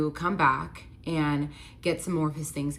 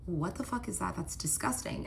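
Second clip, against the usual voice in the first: disgusted mutter; 8 dB quieter than usual. Retold or imitated. Seeing spit land on friend.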